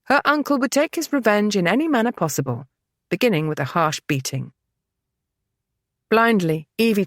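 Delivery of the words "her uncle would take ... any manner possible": ordinary delivery, nothing marked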